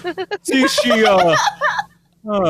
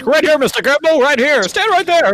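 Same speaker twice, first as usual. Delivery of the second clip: higher, excited photographer calling out; press photographers calling; 7 semitones higher than usual. Acted. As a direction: press photographer waving the men into place, pitched up.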